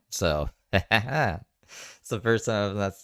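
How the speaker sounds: ordinary talk, nothing marked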